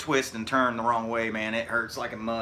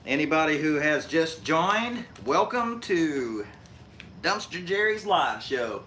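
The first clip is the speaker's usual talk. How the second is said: show announcer; 3 semitones higher, slower than usual. Real, not acted. Announces his live show.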